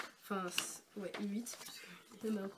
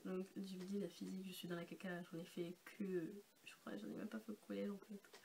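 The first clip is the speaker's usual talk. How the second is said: quiet; 3 semitones lower, 8 dB quieter than usual. Real, not acted. Embarrassed aside about physics.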